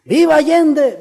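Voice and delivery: high, loud rallying cry; Independence Day shout. Acted. An official honoring heroes before the town, ceremonial shout. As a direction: call it out like the official grito. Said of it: "Y el grito es".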